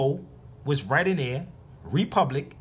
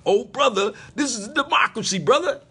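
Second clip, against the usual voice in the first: high, loud mock preachy voice, rising higher; mocking know-it-all; 8 semitones higher, 4 dB louder than usual. Mocking people who insist it's a democracy, high and loud. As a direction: ham up a smug lecturer. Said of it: "that's what people keep saying".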